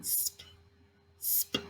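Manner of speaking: "Sp, sp" breathed as a soft unvoiced onset; whispered sound. Shown as a demonstration. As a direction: whisper the blend "sp" very softly. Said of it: "what do we hear in the beginning".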